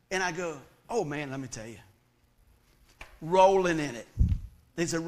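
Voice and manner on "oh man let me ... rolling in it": mock boasting. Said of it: "boasting to curious friends".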